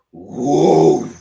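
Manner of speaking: big loud dog woof, rising from low; sudden booming bark; imitated dog bark. Storyteller mimics the dog he just heard, loud bark. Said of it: "I just heard".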